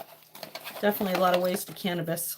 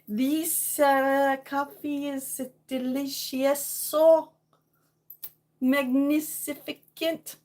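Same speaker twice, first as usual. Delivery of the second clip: higher, slow, hammed-up Italian; mock Italian accent; 3 semitones higher, much slower than usual. Streamer praising her coffee, in a hammed-up Italian accent. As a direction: savor it slowly in a playful Italian accent. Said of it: "that sounds more like Italian".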